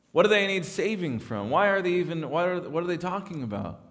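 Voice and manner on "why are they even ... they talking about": ordinary talk, nothing marked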